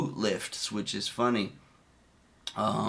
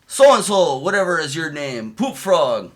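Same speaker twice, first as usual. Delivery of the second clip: mock drill sergeant; 3 semitones higher, 6 dB louder than usual. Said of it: "He goes".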